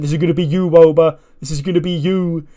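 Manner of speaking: a retold yell at a rival, half-shouted; mild mock scream. Wrestler yelling at his absent rival, mild mock scream. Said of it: "he's, like, screaming".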